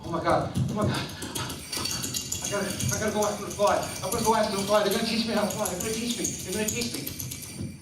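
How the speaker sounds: fast, high, breathless excitement; giddy turtle. Acted. Turtle spotting the swans, high and tumbling with excitement. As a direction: heart racing, words tumbling out. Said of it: "That's how it sounded".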